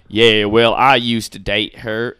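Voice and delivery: loud, slow, mock Southern accent. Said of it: "I'm too good at a Southern accent to do his Southern accent".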